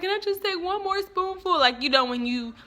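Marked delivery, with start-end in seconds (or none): 0.0-1.6 higher, whiny begging; lightly voiced little-girl plea; mock pleading kid. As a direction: beg like a kid stuck at dinner. Child begging dad at table.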